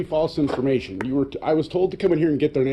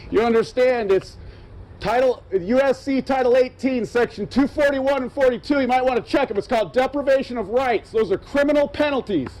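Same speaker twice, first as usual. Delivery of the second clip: angry lecture; 9 semitones higher than usual. Real, not acted.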